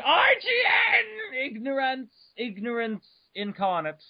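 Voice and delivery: high exclaimed letters; mock outrage. Real, not acted. Reading the review site name, mock outraged cry. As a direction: spit out the name in mock indignation.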